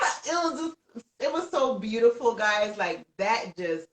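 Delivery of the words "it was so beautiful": ordinary talk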